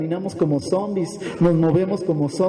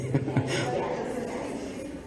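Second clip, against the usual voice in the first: quiet, trailing chuckle; soft laugh; 10 dB quieter than usual. Real, not acted. Preacher joking with the congregation, soft laugh. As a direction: let a soft laugh tail away.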